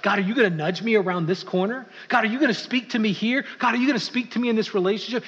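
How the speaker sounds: keen, expectant rising questions; acted excitement. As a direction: ask with mounting anticipation, pitch climbing. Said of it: "It becomes an excitement".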